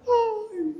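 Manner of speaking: sharp high sigh of despair; high exasperated sigh. Real, not acted. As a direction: sigh at the victim's folly. Gemido de desânimo. (suspiro agudo de desânimo)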